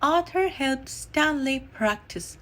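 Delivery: usual speaking voice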